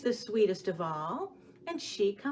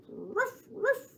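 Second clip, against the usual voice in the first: playful bark, the second one yelped much higher; puppy bark; 14 semitones higher than usual. Acted. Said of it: "what did that puppy say?".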